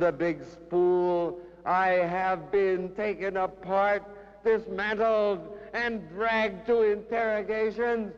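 declaimed poem, climbing to a high, ringing pitch; dramatic recital with rising intensity; impassioned poetry reading. Acted. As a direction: start measured, build to fervent high-pitched recitation.

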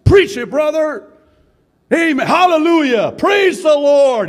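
high, loud front-row shouts; front-row man yelling out during the teaching. Retold or imitated. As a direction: holler each praise at full voice. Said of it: "he would always yell out".

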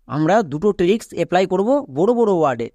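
ordinary talk

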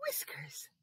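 soft secret whisper. Acted. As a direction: breathe the word out. Whisper to kids.

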